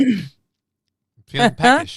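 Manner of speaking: high, loud silly voice; hammed-up hungry character; mock peckish whine. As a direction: squeal it in a put-on voice. Mock refusal to stop eating.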